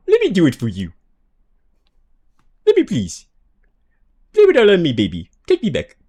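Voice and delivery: high, loud desperate begging; pleading meme voice. Pretend. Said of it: "One of my personal fave voices".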